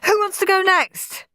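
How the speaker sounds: put-on high-pitched child inviting a turn; excited high boy voice; eager boy. A narrator's reading. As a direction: call for the next victim with glee. Henry pleased with his show.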